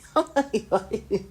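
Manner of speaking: quick high laugh; a real laugh slipping out; brief chuckle; short laugh. Real, not acted. Laughing at the quote.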